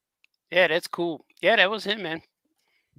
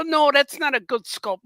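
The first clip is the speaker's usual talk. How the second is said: high, slow, louder teasing wife; mock wife voice; 10 semitones higher, 5 dB louder, slower than usual. Husband imitating his wife scolding about toys, high. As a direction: lightly mimic the wife's complaint. Voicing wife in retold argument.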